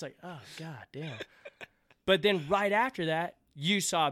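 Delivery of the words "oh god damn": soft, deflated mutter; quietly crestfallen; deflated reaction. Retold or imitated. Acted reaction to a backhanded compliment.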